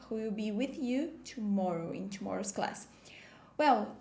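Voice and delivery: ordinary talk, nothing marked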